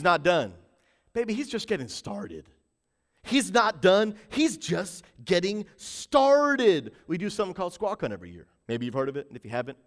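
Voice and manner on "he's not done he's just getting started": slow, stretched preaching delivery; deliberate, weighty repeat; drawn-out emphasis. Real, not acted. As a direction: stretch each word of the promise.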